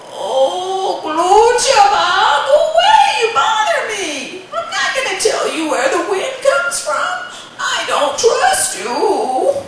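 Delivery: put-on very high, loud cackling old woman shouting; cranky grandmother. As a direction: cackle and scold the boy away. Grandmother refuses.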